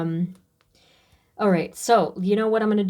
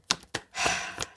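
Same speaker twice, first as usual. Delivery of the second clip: emotional sigh; 8 dB quieter than usual. Real, not acted. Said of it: "you guys are making me so emotional".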